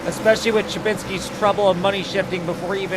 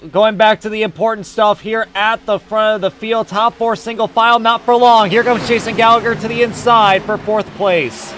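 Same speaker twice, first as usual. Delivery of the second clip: loud, driving race commentary; live play-by-play; 5 semitones higher, 8 dB louder than usual. Real, not acted. Announcer following the front of the field, loud play-by-play. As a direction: project every move to the crowd.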